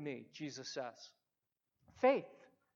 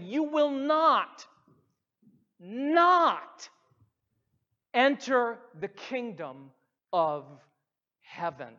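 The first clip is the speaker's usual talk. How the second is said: "not" repeated and driven hard, slow and louder; double negative stressed; 2 semitones higher, 4 dB louder, slower than usual. Shown as a demonstration. Preacher stressing Jesus' double negative to the church, slow and emphatic. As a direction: press "You will not, not" like a solemn vow. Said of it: "It's literally saying".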